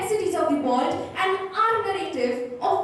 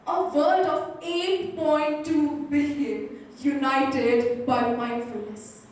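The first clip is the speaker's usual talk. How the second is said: slow dramatic emphasis; 2 semitones lower, slower than usual. Acted. Dramatic vision in speech.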